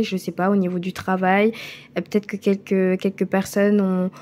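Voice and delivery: usual speaking voice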